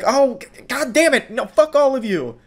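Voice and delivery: high-pitched sputtering imitation; hammed-up high exasperated outburst; mock outrage. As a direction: curse the whole crowd in mock fury. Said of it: "And then she's like".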